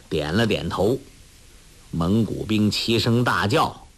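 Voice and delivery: ordinary delivery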